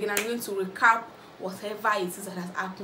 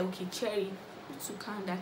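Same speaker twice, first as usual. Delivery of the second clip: calming down; 8 dB quieter than usual. Retold or imitated. Calming Cherry in the fight.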